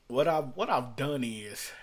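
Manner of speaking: ordinary talk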